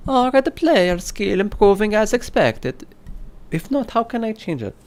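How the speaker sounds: high comic Italian reading voice; Italian accent. Acted. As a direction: keep the hammed-up Italian accent going. Italian voice for lens question.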